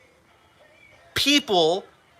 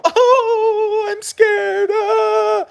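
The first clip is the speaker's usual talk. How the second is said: high, whiny, sing-song fake fear; mock someone cowering, squealing it up high; hammed-up frightened falsetto; mock scared squeal; 13 semitones higher, much slower than usual. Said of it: "you go".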